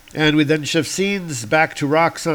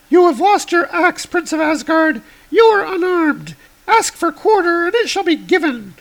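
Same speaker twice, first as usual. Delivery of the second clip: much higher heroic woman; high woman's voice; 6 dB louder than usual. Acted. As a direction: lift the voice high for Girl Thor. Girl Thor voiced.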